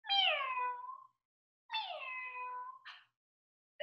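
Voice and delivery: soft, high, drawn-out mew; high pretend kitty call; cat meowing. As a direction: imitate a little cat. Imitates a cat for guessing.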